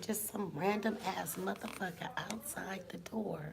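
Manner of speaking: real whisper so the neighbor won't hear; hushed whisper behind the door. Real, not acted. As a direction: whisper it, keeping hidden. Neighbor outside her door.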